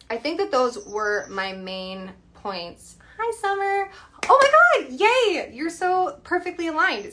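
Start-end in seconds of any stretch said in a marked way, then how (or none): 3.2-7.1 high, loud, delighted squeal of a hello; excited greeting. Real, not acted. Astrologer spotting best friend Summer in the chat, excited.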